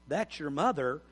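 calm dad setting the rule quietly; firm but gentle father. Retold or imitated. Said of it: "You need to go say".

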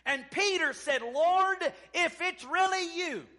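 loud, high, ringing Bible retelling; rousing revival delivery; full-force preaching. Real, not acted. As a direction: cry it out from the pulpit.

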